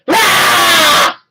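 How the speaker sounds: sudden loud shout to startle; loud scare shout. Real, not acted. Owner shouting to scare away the dog's hiccups. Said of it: "I did go kinda loud".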